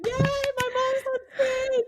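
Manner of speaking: put-on very high, drawn-out kid's cheer; imitated cheering daughter. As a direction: cheer slowly in a squeaky child voice. Daughter cheering in front row.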